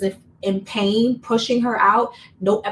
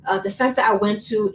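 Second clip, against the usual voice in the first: ordinary delivery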